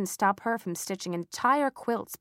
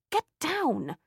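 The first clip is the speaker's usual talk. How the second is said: sharp order, higher; commanding; 8 semitones higher than usual. Acted. Matilda ordering Silas off Mother's quilt, commanding. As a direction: snap the order at the cat. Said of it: "I commanded".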